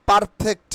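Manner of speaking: "perfect" said par-fekt with a sounded r; the wrong "perfect", clear r in "par"; common mispronunciation. Shown as a demonstration.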